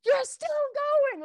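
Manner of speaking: a proud husband cheering from the car; excited disbelief. Retold or imitated. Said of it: "he was so excited".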